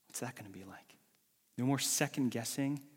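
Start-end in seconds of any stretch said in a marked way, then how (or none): 0.1-1.0 soft unvoiced whisper; whispered wonder. Preacher whispering a question to the congregation. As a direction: wonder aloud in a hush.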